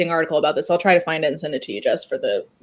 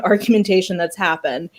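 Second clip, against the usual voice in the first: ordinary delivery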